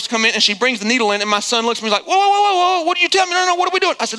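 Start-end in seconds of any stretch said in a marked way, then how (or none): 2.1-3.9 high-pitched imitation of a panicking son; alarmed child. Son balking as the nurse brings the needle in, alarmed. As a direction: voice a kid's mock alarm, pitched up.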